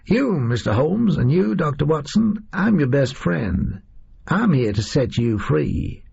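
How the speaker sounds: high, reedy American voice, drawn out. Acted. Oswald announces himself Holmes and Watson's liberator, high and reedy. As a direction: pitch it up thin and reedy, unhurried. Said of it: "his voice high and reedy".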